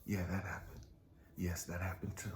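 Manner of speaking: quiet gentle confirmation; God's soft answer. Pretend. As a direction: softly admit it. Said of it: "I can hear God say".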